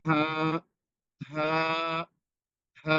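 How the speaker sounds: "হা" breathed freely from an open throat. Shown as a demonstration. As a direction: let "হা" out with no catch in the throat. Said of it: "একবারে গলা ছেড়ে দিয়ে উচ্চারণ করবেন".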